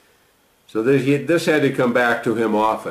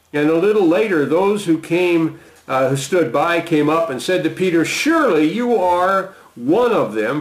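raised pitch, earnest reading of Peter's vow; scripture reading; 7 semitones higher than usual. Acted. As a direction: lift the voice to read Peter's bold promise. Reading the later accusations.

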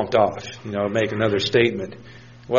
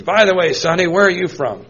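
put-on high, louder old man voice; a cranky old man's pointed question; old man asking; 5 semitones higher, 5 dB louder than usual. Old man quizzing the young interviewer, high and loud. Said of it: "And he says".

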